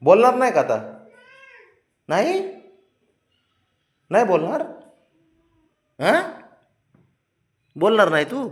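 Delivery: high-pitched, wheedling questions with pauses between; coaxing questions. Real, not acted. Father coaxing sulking child to talk. (उंच, लाडीक आवाजात मनधरणी)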